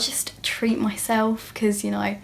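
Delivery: slow whispered aside, like a little secret. Real, not acted. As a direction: whisper it as if confessing. Aside about treating herself.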